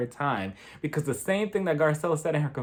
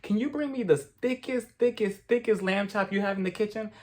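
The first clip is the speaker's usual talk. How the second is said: higher, faster sugary request; sweetly calling Patrick; 5 semitones higher than usual. Pretend. Pretend Sutton calling Patrick over, sugary higher. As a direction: ask sweetly for the lamb chop. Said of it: "I would have been like".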